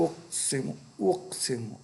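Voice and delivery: "اقسم" said softly and clearly, vowel by vowel; "اقسم" soft and careful; vowel demo. Shown as a demonstration. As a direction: model "اقسم" for the pupil. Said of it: "اب یہ".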